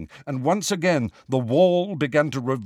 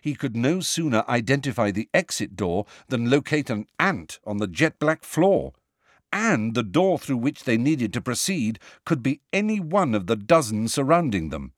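usual speaking voice

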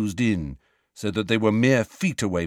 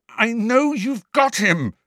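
panicked insistence; 14 semitones higher, 4 dB louder than usual. A narrator's reading. Harry panicking.

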